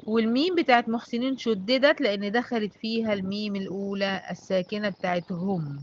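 ordinary talk

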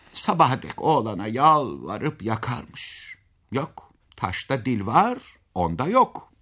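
ordinary delivery

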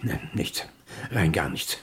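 half-whispered, let down; whispered disappointment. Acted. Old man straining to hear his lost keys, whispering. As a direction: breathe it out, nothing there. Listening in silence, nothing heard.

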